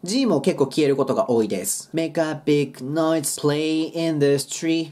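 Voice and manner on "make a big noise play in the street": slow "playing" with no final G; dropped G. Shown as a demonstration. Teacher demonstrating the silent G, slow and linked. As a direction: model "Make a big noise, play in the street" slowly, G silent. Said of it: "ここのGも消えて".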